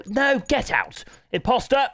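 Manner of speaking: high, loud mock shout of imposter; mock outrage. Real, not acted. Commentator shouting at a fake rider in the lobby. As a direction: shoo them out with playful outrage. Shooing an unwanted lobby joiner.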